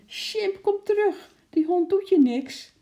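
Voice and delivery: very high, loud frantic shriek; shrieking call. A narrator's reading. Lotje shrieks up at the window for Sjimp to return. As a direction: shriek for him to come back. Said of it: "gilde Lotje".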